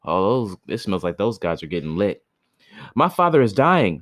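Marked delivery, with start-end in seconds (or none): 2.9-4.0 put-on higher, louder pleading boy; a poor boy begging for his father; a lightly voiced boy pleading for his dying father; boy's plea. A narrator's reading. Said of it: "said the boy".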